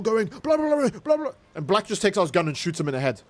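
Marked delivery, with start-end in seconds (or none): 0.3-1.3 high mocking blah blah; mocking Red voice. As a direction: squeak out the boss's rambling. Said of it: "speaking his heart out".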